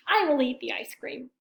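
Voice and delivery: usual speaking voice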